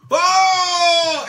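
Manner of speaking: a high, loud, delighted "Oh!"; excited shout. Retold or imitated. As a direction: shout it out like a thrilled fan. Owner recognizes the watch, excited.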